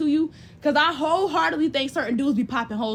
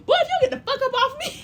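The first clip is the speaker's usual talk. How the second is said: laughing shout; 13 semitones higher than usual. Pretend. Telling off a guy who wants to get comfortable, laughing shout. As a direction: yell him off you while cracking up.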